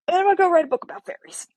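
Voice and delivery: high, fast, gleeful proclamation of her plan; mock triumphant declaration. Woman announcing her fairy book plan, high gleeful triumph. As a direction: sing out the fairy plan like a joyful vow.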